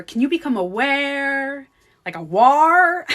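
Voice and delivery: exaggerated hypnotic guru impression; Joe Dispenza. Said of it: "the sound that he makes with his voice".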